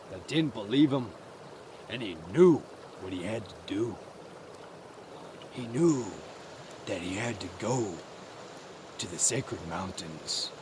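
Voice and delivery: low, soft, halting storytelling; hushed, solemn narration. A narrator's reading. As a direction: sink low and quiet with solemn resolve. Narrator tells mouse's solemn resolve.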